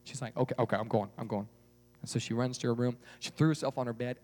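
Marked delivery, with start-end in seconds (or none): 0.3-1.5 low, subdued mumble of giving in; meek surrender. Acted. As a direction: back down softly. Girl gives in to mother.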